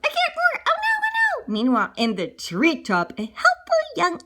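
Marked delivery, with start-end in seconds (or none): 0.0-1.5 high-pitched dismay; squeaky, distressed little bear; panicked bear. Acted. Fred panics without his roar.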